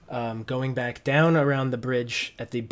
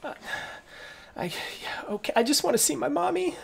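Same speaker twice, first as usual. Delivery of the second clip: put-on child voice; 14 semitones higher, 4 dB quieter than usual.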